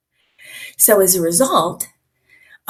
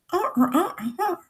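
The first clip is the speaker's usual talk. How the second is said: high wordless droning; cartoon teacher; 10 semitones higher, 4 dB quieter, faster than usual. Shown as a demonstration. Trainer reminds class of Charlie Brown, high wah-wah drone. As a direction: imitate unintelligible talk in high swooping syllables. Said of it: "The teacher".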